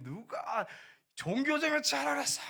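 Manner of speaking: high, loud imitation of a furious superior; angry shout. Returning sergeant rebuking soldier over religion, high loud shout. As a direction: holler it as an outraged accusation.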